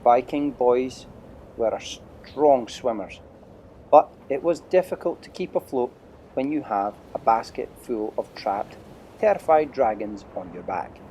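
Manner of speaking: usual speaking voice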